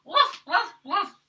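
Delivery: bright barking woofs; playful dog barks. Shown as a demonstration. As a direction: bark for the kids. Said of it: "And what do dogs say?".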